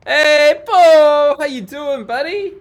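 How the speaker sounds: loud, high-pitched call to a buddy; big high hollered greeting; excited greeting shout. Real, not acted. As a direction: holler hello in a high voice, easing off after the name. Greets a viewer arriving on stream.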